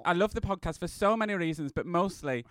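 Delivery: ordinary talk, nothing marked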